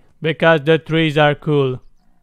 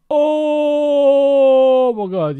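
long, drawn-out high cry; drawn-out high-pitched amazement; excited awe; 9 semitones higher, much slower than usual. Real, not acted. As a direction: stretch it out high and slow. Exclaims at the game's view.

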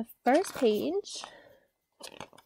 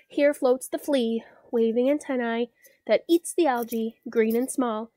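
rhythmic building poem reading; reading to a toddler; 5 dB louder than usual. A narrator's reading. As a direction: build the chant line by line. Reads cumulative pond poem.

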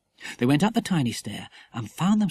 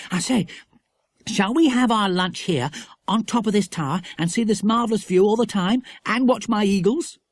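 put-on higher, louder keen boy voice; eager boy; 7 semitones higher, 4 dB louder than usual. A narrator's reading. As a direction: propose it with relish.

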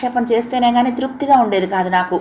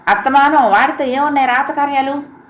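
a hint of a scolding mother, higher; sharp mother's voice; 6 semitones higher than usual. A narrator's reading. Mother scolding daughter about the servant boy, sharp. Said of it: "అమ్మ గొంతు ఖంగుమంది".

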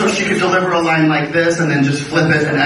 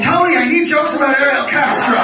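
a lightly voiced Joan Rivers, pitched higher; imitating Joan Rivers; 9 semitones higher than usual. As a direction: quote Joan in a higher, eager voice. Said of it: "So it's Joan Rivers saying".

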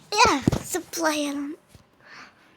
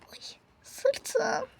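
whimper of pain; 6 semitones higher, 9 dB quieter than usual. Acted. Hurt after landing.

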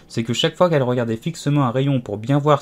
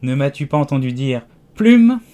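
furious sheep; 3 semitones higher, 7 dB louder than usual. Acted. Sheep scolding Alice for not listening, building to a furious shout. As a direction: explode on the final word. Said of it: "avec une voix furieuse".

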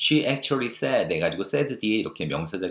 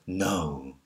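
slow, drawn-out gentle coo; forbidding words in a sweet, lilting tone; cooing intonation; 2 semitones lower, much slower than usual. Shown as a demonstration. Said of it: "이 억양을".

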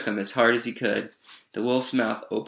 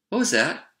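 high, quick startled question; puzzled little girl; 6 semitones higher than usual. A narrator's reading. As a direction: pipe up, curious. Girl asks about the rumble.

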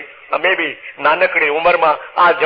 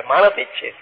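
ordinary talk, nothing marked

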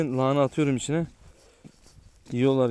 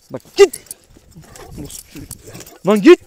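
angry yell; 7 semitones higher, 11 dB louder than usual. Real, not acted. Chasing the dog away.

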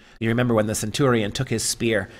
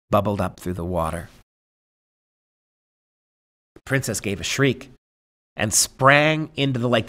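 story narration. Acted. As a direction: read the tale aloud, letting each image land. Reads the tale aloud.

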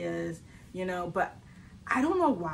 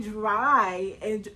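usual speaking voice

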